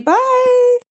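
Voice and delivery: very high, loud sing-out; long, high, sustained farewell; called-out goodbye. Real, not acted. Caller signing off to everyone, calling out brightly.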